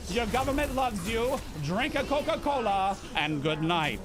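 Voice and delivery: put-on old-time radio sponsor read; lightly done Mid-Atlantic ad read; Mid-Atlantic sign-off. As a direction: sign off the broadcast like a 1950s announcer. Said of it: "the Mid-Atlantic era".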